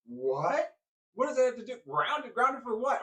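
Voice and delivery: high, loud, indignant disbelief; outraged protest. Retold or imitated. Child protesting being grounded, loud and high. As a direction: protest the unfair punishment.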